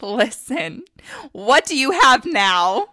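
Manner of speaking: rising mock rant at a future husband; sassy loud telling-off. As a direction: put him in his place, loud and sassy. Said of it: "I'm gonna look at them and say".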